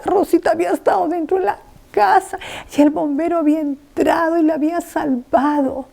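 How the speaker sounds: ordinary talk, nothing marked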